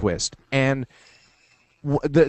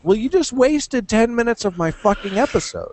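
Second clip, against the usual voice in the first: annoyed viewer; 9 semitones higher than usual. Retold or imitated. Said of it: "And you're like".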